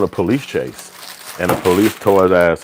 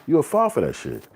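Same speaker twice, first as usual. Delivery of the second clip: usual speaking voice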